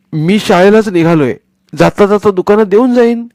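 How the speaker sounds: put-on smooth-talking the old lady; eager boy. Boy Sonya persuading grandmother he'll take the money, eager.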